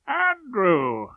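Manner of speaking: a lightly hearty call across the street; matey greeting. Acted. Old barrister hails a schoolboy on the street, mildly matey. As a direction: call out to a boy like an old pal. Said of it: "I called out in my matiest tones".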